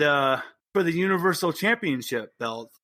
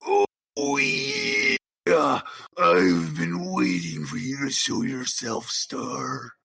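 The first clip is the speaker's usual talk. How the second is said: booming wrestler heel calling out; wrestler taunt; 4 semitones lower, much slower than usual. Acted. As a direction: taunt him like a pro wrestling villain. Rothgar taunts Star from the hall.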